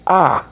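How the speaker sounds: high loud yelp of pain, hammed-up; pained cry. Mark moving his head mid-surgery, high pained yelp. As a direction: yelp like a sharp pain hit you. Retold character feels pain again.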